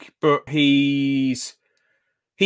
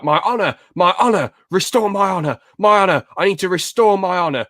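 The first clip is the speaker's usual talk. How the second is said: imitating Zuko, higher and insistent, chanting the word honor; mock the prince fixated on restoring his honor; obsessed Zuko; 6 semitones higher, 3 dB louder than usual. Fan imitates Zuko's honor obsession for his co-host, higher and hammed-up. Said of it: "he's all bothered about".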